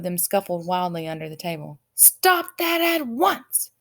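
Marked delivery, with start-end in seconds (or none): 1.9-3.7 very slow, high booming command; thundering. A narrator's reading. King Azaz thundering at the scuffling guests. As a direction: thunder it over the brawl. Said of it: "thundered Azaz".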